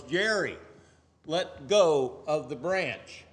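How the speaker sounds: slow, loud heavenly command. Retold or imitated. God tells the dangling man to let go, slow command. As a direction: order him calmly from above to let go. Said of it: "He goes".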